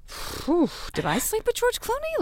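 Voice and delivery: high, dreamy mock-breathless swoon; giddy swoon. Recommending Out of Sight to her friend, giddy high swoon. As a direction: swoon at him like it really happened. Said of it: "afterwards I'm like".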